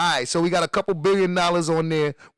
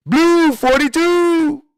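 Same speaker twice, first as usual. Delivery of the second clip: high, loud, drawn-out snap count; quarterback's play call; 7 semitones higher, 8 dB louder, much slower than usual. Acted. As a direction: holler it slowly like a quarterback. Mimics quarterback calling a play.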